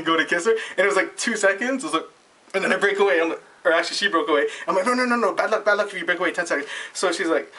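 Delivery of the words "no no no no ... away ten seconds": high, hurried mock panic; urgent protest. He warns the waitress of bad luck, high urgent protest. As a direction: blurt out a pleading warning, pitched up. Frantic warning as she breaks away.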